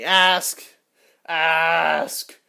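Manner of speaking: loud, high shout of "Ask!"; shouted command. Real, not acted. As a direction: bark it out twice, full volume. Answering "does my crush like me.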